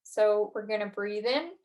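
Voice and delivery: calm guided breathing cues, long pauses. Acted. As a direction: lead the breath counts calmly.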